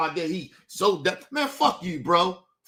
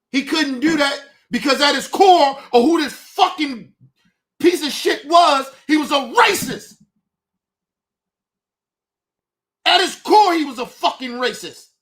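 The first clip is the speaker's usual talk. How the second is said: loud, higher, furious yelling; furious shout; 3 semitones higher, 7 dB louder than usual. Real, not acted. Venting rage at the dead host, loud furious yelling. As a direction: blast it out in real rage.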